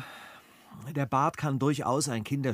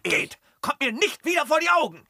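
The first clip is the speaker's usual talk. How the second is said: higher, louder furious order; angry dismissal; 11 semitones higher, 6 dB louder than usual. Acted. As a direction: drive him out of your sight. Master throws out his thieving servant.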